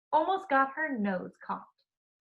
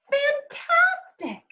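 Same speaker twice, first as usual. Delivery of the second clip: much higher, slow gushing praise; excited praise; much slower than usual. Acted. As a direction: gush over the feat. Princess praising the dragon.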